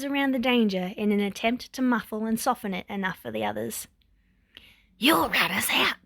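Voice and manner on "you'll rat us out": whispered menacing snarl; breathy menacing snarl. A narrator's reading. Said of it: "snarls Shigaraki".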